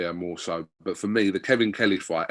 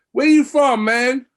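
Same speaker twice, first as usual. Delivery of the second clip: put-on "Where you from, man?" much higher and louder in a brash New York American accent; Kevin Kelly's accent; 12 dB louder, slower than usual. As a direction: bark it like a cocky New York American fighter. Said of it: "we get the accent".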